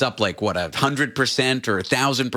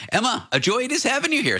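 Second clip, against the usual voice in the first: ordinary talk, nothing marked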